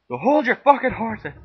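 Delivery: high, loud shout at the viewers; yelling. Real, not acted. Cook tells the viewers to wait, yelling. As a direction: bark at them to be patient.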